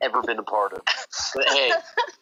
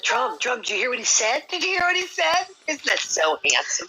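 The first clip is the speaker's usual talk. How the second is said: much higher, gushing, fawning tease; mock swooning D; 8 semitones higher than usual. Teasing D who gushes to Drum about a handsome guest. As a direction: gush over him in a high swoon. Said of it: "she's gonna be like".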